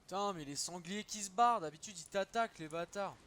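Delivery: real annoyed yelling; exasperated outburst. Real, not acted. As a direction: vent loudly at the cowardly boars. Boars fleeing instead of attacking.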